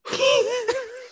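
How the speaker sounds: high-pitched squeal of laughter. Real, not acted. Reacting to her own joke.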